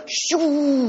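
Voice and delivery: high airy shoo sound; whooshing shoo. Retold or imitated. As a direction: imitate the tract flying. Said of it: "and it perfectly went".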